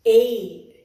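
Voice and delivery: "a" as the short vowel in apple; phonic A sound. Shown as a demonstration. Teacher showing children the sound of A, short phonic vowel. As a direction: say "a" short and crisp, not the letter's name. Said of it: "speaking of the sound of the letter".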